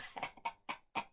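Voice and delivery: soft, voiceless snicker; sly chuckle. Acted. Innkeeper lures the traveller in, sly soft snicker. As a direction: chuckle under your breath.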